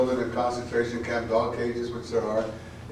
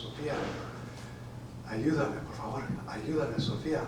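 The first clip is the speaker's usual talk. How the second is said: dying plea; 8 dB quieter than usual. Acted. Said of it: "leaving Tonal's jagged voice".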